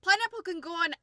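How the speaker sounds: excited high quote of the fighter; loud, high and excited, quoting the fighter; character line from the show. Retold or imitated. Scene: the fighter insists pineapple belongs on pizza, hammed up and loud. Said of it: "it was like".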